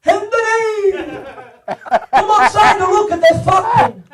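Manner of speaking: high, drawn-out Italian father's holler; calling from outside. Retold or imitated. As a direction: holler excitedly for your son from outdoors. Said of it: "all of a sudden, from outside".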